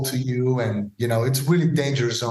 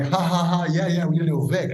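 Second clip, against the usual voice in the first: mock laughing agreement, higher and quicker; laughing reply; 4 semitones higher than usual. Kiko laughing as he confirms they need a new Vic. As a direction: laugh it off and say yes. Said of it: "And he was like".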